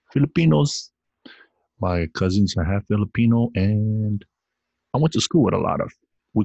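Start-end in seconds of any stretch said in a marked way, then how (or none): 0.1-0.9 Filipinos sung out high; hyped shout-out. Real, not acted. Podcast host hyping up his Filipino partners, excited. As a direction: celebrate the word Filipinos.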